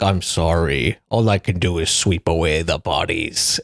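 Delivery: low, solemn put-on voice; Stormfather. Storm spirit apologizing to Dalinar, low role voice. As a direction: drop low and intone the apology. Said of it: "I like how Stormfather just dances around".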